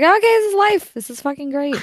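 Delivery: high excited voice. Retold or imitated. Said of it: "I'm just like".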